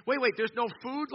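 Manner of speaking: high, loud mock disbelief; imitated whining. Mission travelers complain about no food, mock whining. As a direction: question it like a put-out traveler. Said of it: "people are whining at me".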